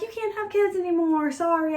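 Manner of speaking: high-pitched, unapologetic "sorry"; mock blunt decree. Acted quote of Amulius to wife.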